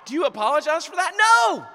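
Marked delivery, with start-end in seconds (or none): 1.1-1.7 high, loud emphatic yell; preaching at full force; shout. Real, not acted. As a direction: hammer it home at full voice. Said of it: "preacher shouting".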